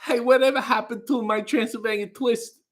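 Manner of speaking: put-on vampire guy's Transylvanian accent; Dracula accent. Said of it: "the Dracula vampire guy accent".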